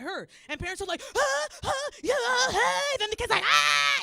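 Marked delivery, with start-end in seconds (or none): 1.2-3.0 high, loud panicked gasps; freaked-out parent gasps. Retold or imitated. Parents overreacting to hurt child, mock panicked gasps. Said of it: "the parents teach them how to freak out". 3.4-4.0 put-on high, loud childish wail; fake crying scream. Kid copying freaked-out parents, fake crying scream. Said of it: "It's crocodile tears".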